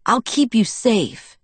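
high, slow, eager assurance; promising. A narrator's reading. Jacob reassures Bella about diving, promising. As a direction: promise it earnestly, drawing it out. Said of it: "he promised".

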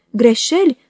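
a mild, understated outburst of Greșeli, close to reading voice; cry out in tears. A narrator's reading. Dartle crying out in protest to David, a mild tearful cry. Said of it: "a strigat ea izbucnind în lacrimi pătimașe".

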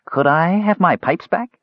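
high, hopeful asking; hopeful kender. Acted. Kender gazes at the mage, high hopeful plea. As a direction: ask hopefully like a child.

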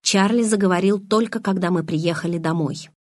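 usual speaking voice

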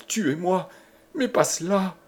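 high, pleading despair; distraught high-pitched plea; anguished gasp. A narrator's reading. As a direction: beg breathlessly in torment. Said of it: "haleta Jeanne dans un cri déchirant".

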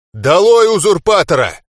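loud, high battle cry; rallying shout. A narrator's reading. Глак leaping up, shouting down with the usurper. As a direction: shout it like a slogan.

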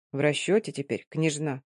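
low, soft and cold; unsmiling. Acted. Mordo asks Mary coldly, low and soft. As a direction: ask it dry, without a smile. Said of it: "без улыбки спросил".

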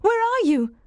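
high, urgent calling out; urgent cry. A narrator's reading. Jane answering Rochester's voice, crying out urgently. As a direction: cry out in answer, pitched right up. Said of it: "I cried".

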